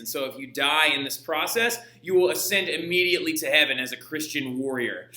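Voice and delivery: ordinary talk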